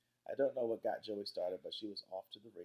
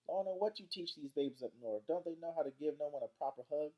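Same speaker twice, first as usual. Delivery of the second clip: higher-pitched Southern grandma, mock scolding; 7 semitones higher, 4 dB louder than usual. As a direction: chide them like an old Southern granny. Narrator voices scolding Grandma Sands.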